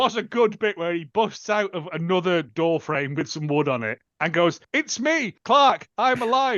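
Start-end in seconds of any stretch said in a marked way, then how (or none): 4.7-6.6 higher, louder, slow triumphant announcement; mock heroic entrance yell; hammy hero line. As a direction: burst in proclaiming you're alive like a cheesy action hero. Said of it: "the exact same intonation".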